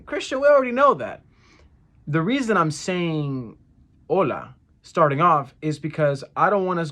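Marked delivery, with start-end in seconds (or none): 0.0-1.2 higher, quicker protest from the class; imagined student objection. Acted. Students interrupting their Spanish teacher, higher protest. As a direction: cut in as a know-it-all pupil, pitched up. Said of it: "some of you guys might be going".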